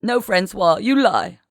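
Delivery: high, hurt accusing shout; narrated tearful outburst. A narrator's reading. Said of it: "Genevieve shouted, her eyes filling".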